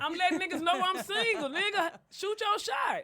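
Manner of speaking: high, loud challenge to suitors; loud call-out. Real, not acted. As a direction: holler it out to the single listeners. Calls out to would-be suitors.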